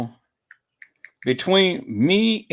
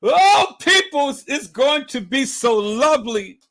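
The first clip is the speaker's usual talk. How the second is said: high, loud, gushing delight; gleeful anticipation; 9 semitones higher, 5 dB louder than usual. Real, not acted. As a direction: gush to the audience, opening long and loud.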